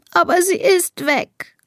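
high, slow tearful protest; sobbing. A narrator's reading. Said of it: "schluchzt Elisa".

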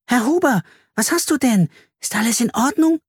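put-on high, anxious child's voice; worried child. A narrator's reading. Carla asks the sad baker what is wrong, high and worried. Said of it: "fragte Carla besorgt".

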